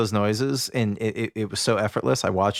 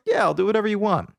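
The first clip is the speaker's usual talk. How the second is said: higher-pitched, mildly eager yes; lightly acted eagerness; eager agreement; 7 semitones higher, faster than usual. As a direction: imitate someone happily up for anything. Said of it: "super eager".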